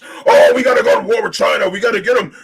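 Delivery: hammed-up hawkish pundit, loud; mild mock war-hawk rant; mock warmonger. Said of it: "banging the war, the war drums".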